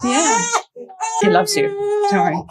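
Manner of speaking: high, tearful reassurance; crying while reassuring her friend. Real, not acted. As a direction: reassure through real tears, pitch way up. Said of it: "I'm only crying 'cause I'm hormonal".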